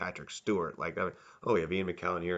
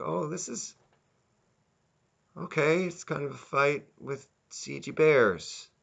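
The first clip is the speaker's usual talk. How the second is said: higher, sarcastic musing on the CG bears; unimpressed mock reaction; 7 semitones higher than usual. Reviewer mocking the CG bear fight, higher and sarcastic. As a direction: play it as a let-down viewer, eyebrows raised.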